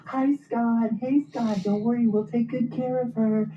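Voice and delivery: put-on very high, sing-song tipsy girlfriends calling out; squealing girlfriends. Friends chirping at the husband on FaceTime, very high and sing-song. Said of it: "they're all like".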